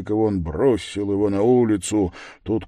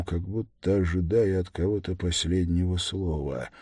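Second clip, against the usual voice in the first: ordinary delivery, nothing marked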